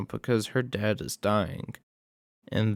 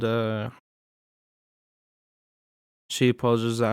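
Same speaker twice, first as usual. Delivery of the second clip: normal talking voice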